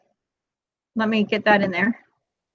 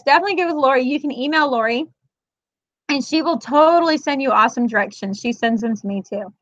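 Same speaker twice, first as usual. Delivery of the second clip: usual speaking voice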